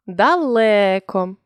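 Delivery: "Далеко" with the middle "ле" drawn out slowly; stressed second syllable. Shown as a demonstration. Teacher checking an unclear vowel by stress, "Далеко" with lengthened "ле". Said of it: "Перевіряємо наголосом".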